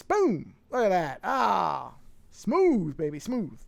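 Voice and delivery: loud, high burst of showy delight; playful triumph. Real, not acted. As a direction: celebrate a slick little trick. Celebrates a smooth scene switch.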